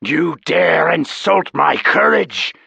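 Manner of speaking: loud, slow, outraged snarl; affronted monster roar; indignant dragon. Acted. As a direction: take the insult like a proud beast. Offended dragon answers.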